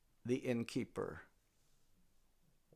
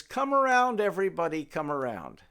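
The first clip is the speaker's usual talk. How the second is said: calling out; 2 semitones higher, 8 dB louder than usual. Acted. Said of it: "He says".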